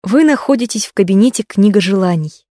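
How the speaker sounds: ordinary talk, nothing marked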